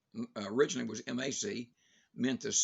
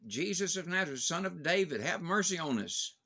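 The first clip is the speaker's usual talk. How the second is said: storyteller voicing the blind men's raised plea; crying out; 6 semitones higher than usual. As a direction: call out for mercy over a crowd. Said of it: "they began to shout and cry out".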